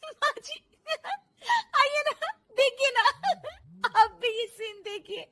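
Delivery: put-on high-pitched young bahu voice; high, excited, naive bahu; giggly, innocent daughter-in-law. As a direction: gush about the show in a high voice.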